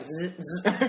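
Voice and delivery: buzzing zzz like a taser; mock electric buzz. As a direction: imitate a taser crackling.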